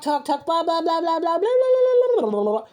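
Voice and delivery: jeering yada-yada, shooting up high then dropping; mocking babble. Reader mocking all the nonsense people talk, mocking babble. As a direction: run the blahs together into rising gibberish. Mocking someone's empty chatter.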